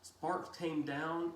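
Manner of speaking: plain reading voice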